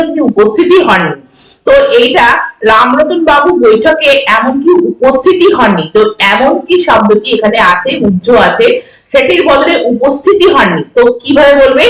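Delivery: ordinary delivery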